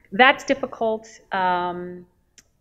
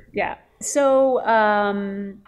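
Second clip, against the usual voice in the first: ordinary delivery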